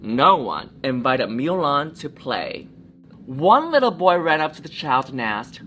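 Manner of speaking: put-on lively read-aloud for children; storybook reading. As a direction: tell it like a bedtime story. Story narration.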